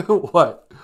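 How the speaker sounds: ordinary talk, nothing marked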